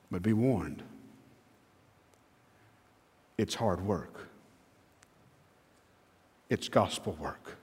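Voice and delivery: low, hushed and weighty; soft, grave caution; solemn warning. Real, not acted. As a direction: drop right down, with long pauses between phrases. Solemn warning after the rally.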